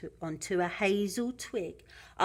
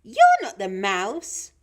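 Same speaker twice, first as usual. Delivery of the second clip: put-on loud childlike challenge; Gruffalo's child; 3 semitones higher, 10 dB louder than usual. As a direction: pitch leaps on the first word, end rising.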